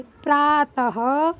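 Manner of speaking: प्रातः drawn out very slowly, the ending said as ह; slow syllable reading. Shown as a demonstration. Teacher showing that the dots are read as ह, very slow. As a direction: stretch प्रातः slowly with a breathy ह at the end. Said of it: "बिंदियों को ह पढ़ेंगे".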